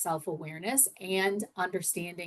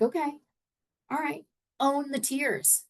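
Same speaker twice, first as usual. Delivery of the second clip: higher, upbeat encouragement; encouraging; 7 semitones higher, 4 dB quieter than usual. Retold or imitated. Coach telling a crying lady to own her tears, encouraging. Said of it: "And so I'm like".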